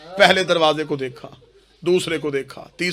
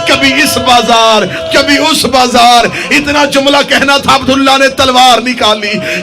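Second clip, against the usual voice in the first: high, loud, impassioned mourning sermon; sustained shouted elegy, drawing out key phrases; full-force lament preaching; 9 semitones higher, 11 dB louder than usual. Acted. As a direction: cry the tragedy out to the whole gathering.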